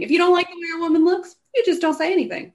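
high, loud, fired-up point; high and loud, driving the point home; emphatic. Real, not acted. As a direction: push the advice hard and high. Emphatic advice rant.

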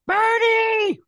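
high, loud hammed-up yell; piercingly high mock summons; mock holler. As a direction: holler his name like a nagging complainer. Mock Marge hollering for Bernie.

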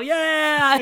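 loud, high drawn-out cheer; mock crowd cheer. Audience answering if they enjoy it, imitated loud cheer.

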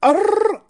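high, loud tongue-rolled "arr"; imperfect imitation of a rapper's trill. Podcaster retrying a catchphrase sound, high trill. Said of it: "I don't think I'm doing an authentic [name]".

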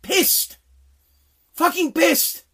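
high-pitched, emphatic indignation; pissed off. Real, not acted. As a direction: punch out each word in anger. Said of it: "I'm pissed".

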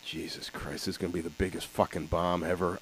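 imitating a panicked whisper; mock panicked whisper. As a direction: whisper the dread like a nervous producer. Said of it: "Harry Ackerman's like".

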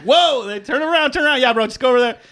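high-pitched retold warning; urgent warning. Warning passers-by of dog.

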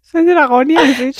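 high and loud squeal of delight; amused squeal. Real, not acted. Friend repeating the mangled name, high amused squeal. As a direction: squeal it back in disbelief.

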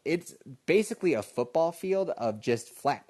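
normal talking voice

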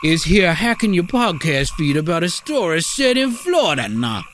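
high hammed-up Cajun restaurant owner; cartoonish Cajun accent, sing-song in places. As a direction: sell it like a proud Cajun cook, Cajun accent. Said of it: "two fine Cajun gentlemen".

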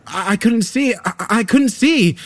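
high, loud panicked scream. A narrator's reading. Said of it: "I screamed".

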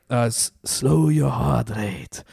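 slow, shaky attempt at an Icelandic accent. Retrying the Icelandic coach's voice for his friend, slow accent attempt. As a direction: try the coach's Icelandic accent again, drawn out. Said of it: "it's a tough accent to do".

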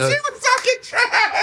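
high laughing squeal over the words. Real, not acted. Host laughing to guest about his mom, high squeal. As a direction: laugh it out in delight.

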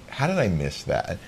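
ordinary talk

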